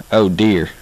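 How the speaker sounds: "oh" in "oh dear" starting on an A glide; Southern twang O. Shown as a demonstration. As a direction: blend A into the "oh" of "oh dear". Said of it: "that's how we pronounce O down here".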